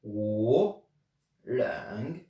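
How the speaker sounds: "wo" then "leng" in Mandarin, a pause between them; teacher saying "wo leng" as a model. Shown as a demonstration. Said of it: "si vous voulez dire j'ai froid".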